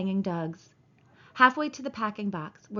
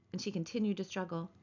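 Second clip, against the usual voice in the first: plain reading voice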